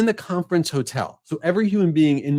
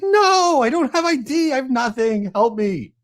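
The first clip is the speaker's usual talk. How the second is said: pitched way up, frantic and helpless; desperate pleading; 10 semitones higher than usual. Retold or imitated. Locked out in boxers, begging the front desk clerk, high and frantic. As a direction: beg like a man caught in his boxers. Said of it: "I was like".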